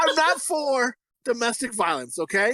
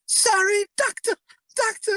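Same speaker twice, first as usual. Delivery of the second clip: high mock-timid apology; scared lisping woman; 12 semitones higher than usual. Remembering joking with a school buddy, mock scared impression. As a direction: imitate her frightened lisp, pitched way up. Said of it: "like, a lisp".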